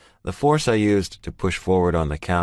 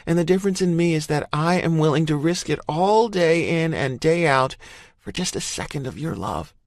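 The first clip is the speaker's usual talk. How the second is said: raised pitch, earnest woman's plea; female character voice; 12 semitones higher than usual. A narrator's reading. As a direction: voice her as a woman pleading. Emerson pleading her case.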